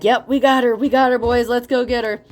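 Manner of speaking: imitating smug villains celebrating; a touch of cocky triumph; gloating enemies. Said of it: "Someone's like".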